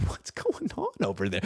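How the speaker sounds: soft, far-off puzzled murmur; muttered in quiet disbelief; quiet bewildered meme quote. Retold or imitated. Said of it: "that meme with the cat".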